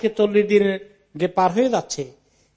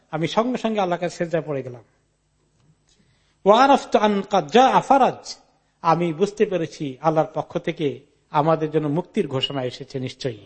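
normal talking voice

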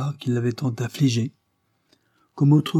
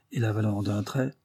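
ordinary delivery

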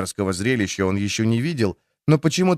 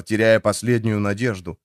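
ordinary delivery, nothing marked

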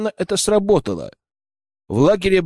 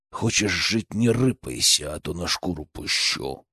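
low soft growling threat; growling bugbear; 9 semitones lower, 7 dB quieter than usual. A narrator's reading. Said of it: "рыкнул я в ответ".

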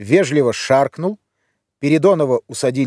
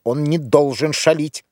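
ordinary delivery

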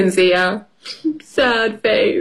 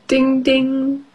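ordinary delivery

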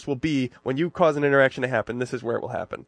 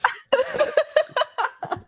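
high squeal laugh. Real, not acted. Big laugh at the scene.

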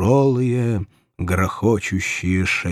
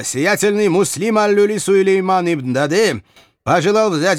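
grandiloquent courtier; 7 semitones higher than usual. A narrator's reading. Aslanbey formal proposal.

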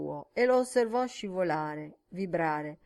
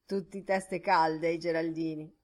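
usual speaking voice

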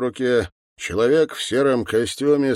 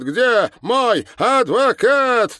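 very high, loud, word-by-word shout; drawn-out yell; 6 dB louder, much slower than usual. Acted. Arrested man repeating his demand for a lawyer, bellowing.

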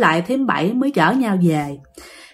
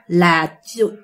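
ordinary delivery

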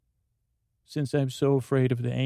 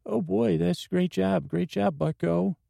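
higher, eager cowboy sidekick; goofy [name] voice; 6 semitones higher than usual. Pretend. [name] asks Richie about his voice, goofy and high. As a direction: keep the silly high twang going.

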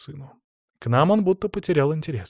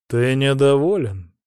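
cold rasping evil emperor, slow; icy voice; 5 dB louder than usual. A narrator's reading. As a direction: ask slowly in an icy tone. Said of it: "ледяным голосом спросил царь". (ледяной голос царя)

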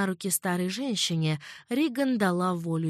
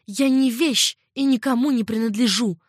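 loud, higher angry cry; defiant and furious; angry exclamation; 4 semitones higher, 7 dB louder than usual. A narrator's reading. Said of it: "зло воскликнула Риган". (злое восклицание, громко)

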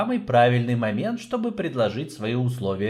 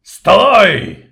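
huge inhuman bark, much louder; 8 semitones higher, 13 dB louder than usual. Acted. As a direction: roar it like a monster. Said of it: "нечеловеческим голосом гаркнул губитель".

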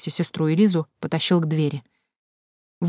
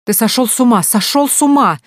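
high, loud, repeated cry; hysterical accusation; 6 semitones higher, 6 dB louder than usual. A narrator's reading. Mother clutching her head at son, hysterical. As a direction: cry it out, higher the second time.

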